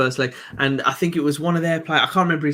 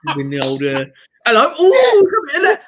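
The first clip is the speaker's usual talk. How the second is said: put-on footballer voice, jumping much higher on the exclamation; Lee Bowyer impression; 10 semitones higher, 4 dB louder than usual. Mimicking a footballer mid-podcast, odd put-on accent. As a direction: ham up a footballer's accent, voice cracking high at the "ooh". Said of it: "doing his Lee Bowyer impression".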